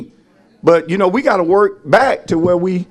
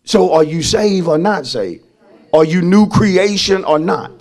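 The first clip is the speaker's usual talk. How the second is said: usual speaking voice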